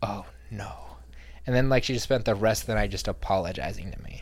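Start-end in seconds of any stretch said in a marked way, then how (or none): 0.0-0.7 breathy embarrassed whisper; mortified hushed oh no; horrified whisper. Retold or imitated. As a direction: gasp it out under your breath. Girl realizes her mistake.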